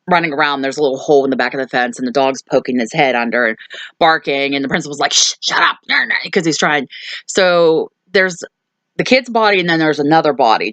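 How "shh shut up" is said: urgent shushing. Retold or imitated. Principal trying to silence the neighbor's barking dog, urgent shushing. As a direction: imitate a sharp, high-pitched shush.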